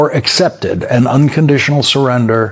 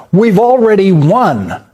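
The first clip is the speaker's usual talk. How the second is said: higher and slow, every word of "We've already won!" pressed hard; mock triumphant exclaim; 6 semitones higher than usual. Anchor mocking Trump's victory post, exaggerated triumphant exclaim. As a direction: read the boast the way it was posted, exclamation and all. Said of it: "exclamation point".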